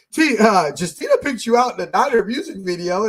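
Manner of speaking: amused boss announcing it; excited retelling. Retold or imitated. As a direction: boss bursting in with the news. Boss laughing retold.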